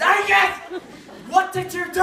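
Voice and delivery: mum's rage. Retold or imitated. Mum blaming Angus for the mess, mock rage. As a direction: accuse him like a furious mum. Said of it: "normal rage that constantly happens".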